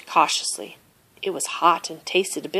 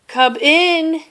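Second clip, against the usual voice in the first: raised, louder rasp of a sick bear; raspy call; 10 semitones higher, 5 dB louder than usual. A narrator's reading. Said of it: "he rasped".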